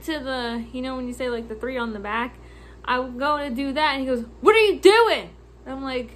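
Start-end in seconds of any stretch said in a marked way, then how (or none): 4.4-5.3 loud, high accusing outburst; retold yelling customer. Customer catching clerk keying numbers, mock yelling. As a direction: yell it like an angry customer. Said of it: "you just yelled at me".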